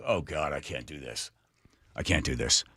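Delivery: low, hushed confession; retold dread. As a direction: quietly admit you can't cope, voice dropped. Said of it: "So Willard cr-, turns to me".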